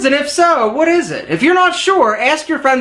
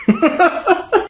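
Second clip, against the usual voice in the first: real laugh; 6 semitones higher than usual. Real, not acted. Blooper laugh.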